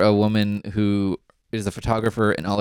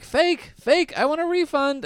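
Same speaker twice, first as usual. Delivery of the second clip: whiny complainer. Retold or imitated. Mocking the angry convention crowd.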